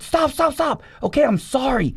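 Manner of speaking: high pleading cry of stop; desperate plea. Retold or imitated. Prisoner pleading with God to stop, desperate high voice.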